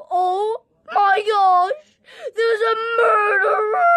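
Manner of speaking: high, loud terrified cries; frantic yelling at a murderer; panicked screaming. Acted. A murderer in the house.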